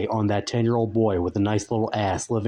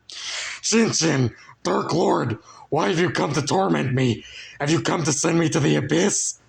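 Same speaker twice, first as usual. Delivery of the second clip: higher, fearful put-on voice pleading; terrified plea; 7 semitones higher than usual. Frank terrified, pleading with the Dark Lord. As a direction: beg the dark lord in terror. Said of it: "paralyzed with fear".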